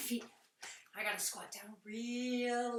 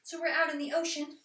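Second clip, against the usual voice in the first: ordinary delivery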